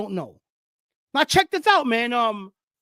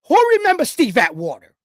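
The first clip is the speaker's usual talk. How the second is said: ordinary talk, nothing marked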